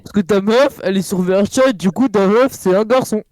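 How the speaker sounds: loud mocking jab; loud teasing. Real, not acted. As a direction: call it out to tease. Loud teasing about girlfriend.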